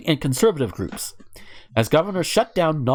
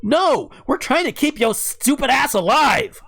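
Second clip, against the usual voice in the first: exasperated rant; 9 semitones higher, 4 dB louder than usual. Real, not acted. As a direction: shout back at them, drawing the words out. Ranting back at the protesters.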